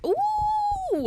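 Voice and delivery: very high excited squeal; shrieking jackpot winner. Real, not acted. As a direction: squeal with delight. Reacts to Italian ice snack.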